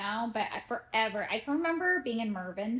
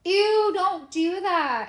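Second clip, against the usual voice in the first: put-on high, loud, drawn-out disgusted kids; mock the girls' slow loud groan; grossed-out daughters; 8 semitones higher, 9 dB louder, much slower than usual. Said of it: "They go, they go".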